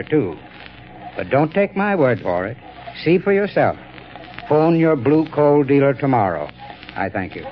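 ordinary talk, nothing marked

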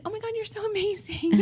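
very high, sing-song adoration; mock gushing. Wife praising husband after the phone restarts, mock gushing. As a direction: gush in an exaggerated high voice.

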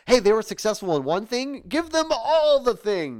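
high, loud mock announcement; exaggerated studio hype, stretching the end out high; gung-ho Hollywood. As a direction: push it up high like an over-eager executive. Mocks Hollywood casting everyone everywhere.

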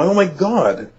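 stunned, high "oh my God"; eureka moment. Retold or imitated. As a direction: let the realization hit all at once. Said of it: "this eureka moment".

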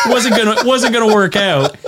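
can't keep the laugh out. Real, not acted. Laughs while retelling friend's failed plan.

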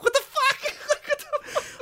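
high squeaky laughing what the fuck, stretched slow; cracking up in shocked disbelief. Retold or imitated. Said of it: "I was like".

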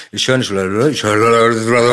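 loud, garbled imitation of jumbled voices; komisches Stimmengewirr. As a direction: babble like too many voices at once. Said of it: "Ich höre diese Stimmen komisch". (wirres, lautes Stimmengewirr nachgemacht)

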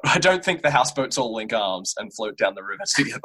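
laughing through the words; giggling while talking. Real, not acted. Joking about houseboats linking arms.

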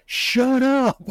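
very high, incredulous mock outburst; exasperated mock scolding. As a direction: squeak it out like a mortified parent. Mock scolding his son, repeated.

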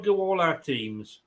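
ordinary delivery

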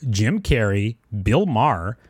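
usual speaking voice

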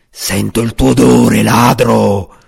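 loud, menacing dragon voice, pleased with itself; a dragon savouring the hunt; satisfied dragon. A narrator's reading. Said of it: "esprimeva la propria soddisfazione". (drago soddisfatto e minaccioso, forte)

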